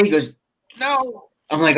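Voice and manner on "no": small, high, pained reply; retold hurt player. Teller voicing injured player, mock pained. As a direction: imitate a hurt man answering weakly, high and soft.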